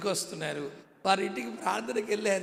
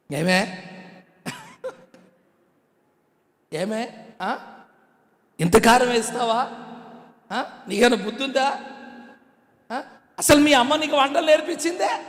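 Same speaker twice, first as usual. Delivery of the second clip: loud, high scolding rant; preacher acting out a furious husband; mock angry husband; 5 semitones higher, 7 dB louder than usual. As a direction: tear into her over the spicy curry. Husband scolds wife over food.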